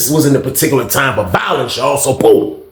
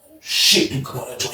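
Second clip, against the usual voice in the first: hushed shock; 2 semitones lower, 7 dB quieter than usual. Retold or imitated. Narrator whispering shock.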